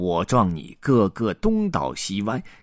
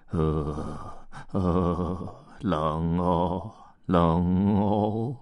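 shivering cold elephant; 2 semitones lower, 3 dB quieter, much slower than usual. Acted.

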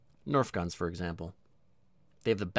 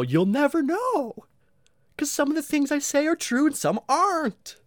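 teasing taunt; 6 dB louder than usual. Acted. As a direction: taunt the listener playfully, pitched way up.

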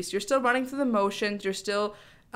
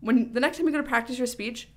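ordinary talk

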